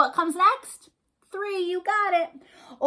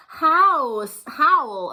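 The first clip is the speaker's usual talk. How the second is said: werewolf howl; 4 semitones higher, 8 dB louder than usual. Acted.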